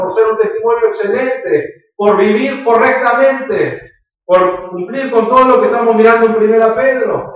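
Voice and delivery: rising, urgent pulpit preaching; fervent preaching. Real, not acted. Pastor urging the congregation to live rightly, fervent preaching. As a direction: press the point home to the congregation.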